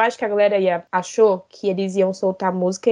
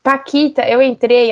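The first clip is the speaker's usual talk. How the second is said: ordinary talk, nothing marked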